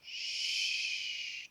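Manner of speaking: "ch" as a long, unvoiced hush; drawn-out SH sound. Shown as a demonstration. Teacher introducing a sound to pupils, drawn-out SH. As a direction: hold "ch" as one steady hiss. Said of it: "le son".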